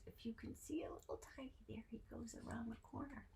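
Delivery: fast soft whisper; hushed whisper pointing to picture. Real, not acted. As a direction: whisper so you don't scare him. Hushed pointing to tiny figure.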